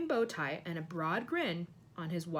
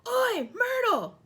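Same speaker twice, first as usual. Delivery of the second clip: loud, high, drawn-out holler; big slow call at full voice; bellowing; 9 dB louder, much slower than usual. A narrator's reading. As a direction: bellow the name across a crowded hall. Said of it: "deep breath and bellowed".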